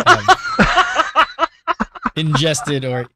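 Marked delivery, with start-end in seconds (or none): 0.3-2.1 loud squeaky laugh breaking out; high burst of laughter. Real, not acted. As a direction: crack up laughing. Laughs while retelling the vet's news.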